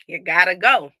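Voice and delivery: loud, playful brush-off; sassy dismissal. Real, not acted. Mom clearing her calendar, loud and sassy. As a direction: shoo it away with sass. Louder repeat dismissing tasks.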